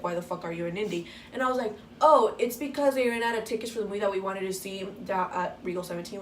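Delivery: ordinary delivery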